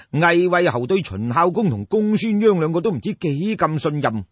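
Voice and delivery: plain reading voice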